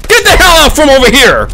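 high, loud yell at the enemy. Real, not acted. Gamer yelling at the enemy player, loud and high. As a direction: bark them off the site.

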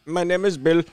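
high imitation Indian agent; mock Indian phone introduction; Indian accent. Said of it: "your Indian voice is awesome".

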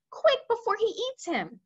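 high urgent plea; girl cries out. A narrator's reading. Sophie begging the BFG, high and urgent. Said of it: "she cried".

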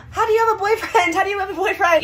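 high mocking voice; mocking viewers. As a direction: sneer it like a doubter. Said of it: "I know you guys are like".

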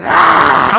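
loud raspy scream; demonic shriek. Retold or imitated. Possessed patient shrieking at the usher. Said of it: "And she said".

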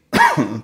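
sudden dry cough; one sharp cough. Real, not acted. Coughing mid-broadcast.

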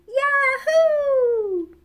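loud, very high, drawn-out holler; whooped shout. A narrator's reading. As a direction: finish on a long piercing whoop. Final whoop of the song.

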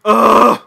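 loud groan of disgust; frustrated groan. Real, not acted. Gamer on losing the match, frustrated groan.

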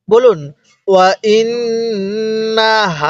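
ওয়া ইন্নাহা slowly, the doubled ন hummed through the nose; with ghunnah. Shown as a demonstration. Teacher demonstrating ghunnah to students, slow nasal recitation. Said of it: "নাকের বাঁশি দ্বারা গুন্নাহ করবেন".

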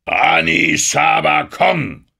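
besorgter Ruf, laut und gedehnt; zweifelnd besorgt. A narrator's reading. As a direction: rufe es besorgt, hoch und langsam. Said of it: "mit zweifelnd besorgter Stimme". (hoher, gedehnter Ruf voller Zweifel)

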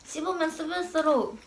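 talking between bites; chewing while talking. Real, not acted. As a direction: mouth partly full. Seen 0: man chews chips, eyes closed, mouth working while speaking.

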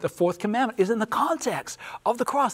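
high, emphatic pulpit summation; fervent preaching climax. Real, not acted. Preacher sums up the everlasting gospel for his panel, fervent preaching. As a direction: drive each point home like a preacher.

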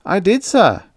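high, loud servant voice; puzzled servant. A narrator's reading. Servant answers his master, high puzzled voice. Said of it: "with a puzzled look".

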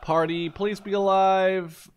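ordinary delivery

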